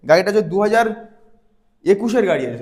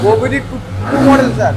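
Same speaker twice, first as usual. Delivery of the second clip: louder, higher, over engine noise; raised voice; 4 semitones higher, 5 dB louder than usual. Real, not acted. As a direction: project over the running bike. Talking over a running engine. (ইঞ্জিনের শব্দের উপর গলা চড়িয়ে)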